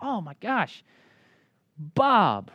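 mock delighted recognition, pausing before the name; surprised greeting. Running into a friend unseen for years, surprised. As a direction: act out running into a long-lost friend. Acting out bumping into old friend.

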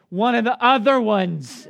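dad imitating his upset son; whiny child. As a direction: stretch it out like a sulky kid. Son Whit melting down.

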